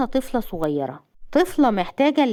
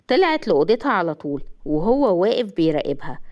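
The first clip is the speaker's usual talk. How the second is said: usual speaking voice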